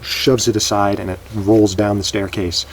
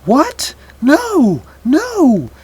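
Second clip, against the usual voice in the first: shrieked, much higher and louder, each word rising; screaming; 9 dB louder, much slower than usual. Acted. Daughter screams in protest as Max sends her off, shrieking. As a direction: scream it out in panicked disbelief. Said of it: "and she just screams".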